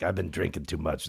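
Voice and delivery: low, softly put-on mom voice; mistaken mom voice. Mom halfway through a day out, badly imitated low voice. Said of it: "That's not how she sounds".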